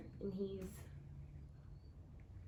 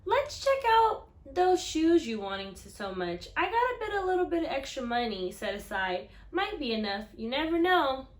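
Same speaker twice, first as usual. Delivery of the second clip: higher, louder hopeful grandma; 4 semitones higher, 10 dB louder than usual. Acted. Grandma telling the boy she saved some money, hopeful grandma voice. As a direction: offer it with a little hope.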